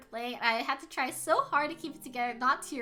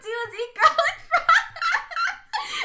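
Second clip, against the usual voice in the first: laughing through the words, higher and louder; 14 semitones higher, 7 dB louder, slower than usual. Real, not acted. Streamer cracking up about garlic fries, laughing.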